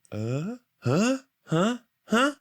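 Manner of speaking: high, baffled grunting huhs; confused huh. Retold or imitated. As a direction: sound lost and thrown off. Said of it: "where they go".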